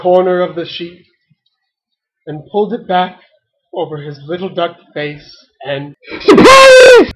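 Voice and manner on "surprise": piercing, high, gleeful shout; yelled at full lungs. A narrator's reading. Five ducks jumping up from the sheets, screaming. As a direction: burst out as loud as you can. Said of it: "yelled at the top of their lungs".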